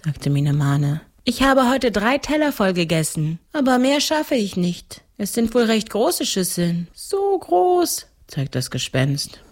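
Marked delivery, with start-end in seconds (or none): 1.2-6.9 put-on higher little-girl voice; seven-year-old girl. 6.9-8.1 very high-pitched ghost voice. Acted. Ghost shows the bowl size.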